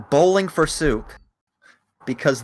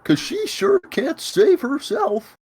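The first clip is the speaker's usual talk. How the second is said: Courage the Cowardly Dog; 10 semitones higher than usual. Pretend. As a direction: whine it like a timid cartoon dog. Courage impression.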